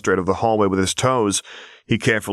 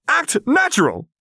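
very high shrill outburst; shrill panic. A narrator's reading. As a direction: squeal it in a panic. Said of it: "Quinn's voice was shrill enough that the sound coming out of the comm started to crackle".

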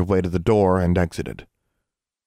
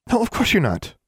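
high, hasty reassurance; said quickly. A narrator's reading. Friend backtracking after being glared at, said quickly. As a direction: rush to agree, voice high. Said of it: "Anna said quickly".

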